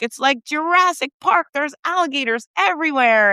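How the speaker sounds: high-pitched imitation of an astonished visitor; amazed tourist. Imitating amazed Scottish tourist's video.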